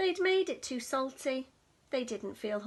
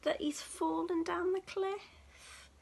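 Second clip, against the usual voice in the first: ordinary delivery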